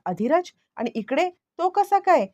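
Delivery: mild shocked stammer, climbing in pitch; shocked disbelief. A narrator's reading. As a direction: caught off guard, voice jumping high on the last question. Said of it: "खूप मोठा धक्का बसलाय".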